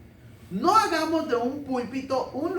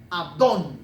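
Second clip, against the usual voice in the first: Abdón with a firm stress on the final O; 4 semitones lower, 5 dB louder than usual. Shown as a demonstration. Preacher teaching how Abdón is stressed, stress on the O. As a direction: land hard on the dón of Abdón. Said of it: "con acento en la O".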